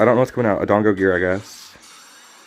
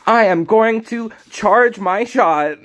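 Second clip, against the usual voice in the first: put-on high, slow anime boy voice; 9 semitones higher, slower than usual. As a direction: pitch way up and drag out each word. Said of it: "That's my ex voice".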